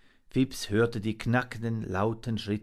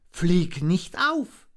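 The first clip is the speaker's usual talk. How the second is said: high little monkey voice, urgent; anxious warning; 8 semitones higher, 5 dB louder, slower than usual. A narrator's reading. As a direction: warn in a raised, anxious voice. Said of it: "warnte Fips".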